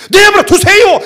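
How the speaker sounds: mock bratty kid talking back, high and loud; sassy retort. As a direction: imitate a defiant son snapping back at dad. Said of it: "이런다니까, 나보고".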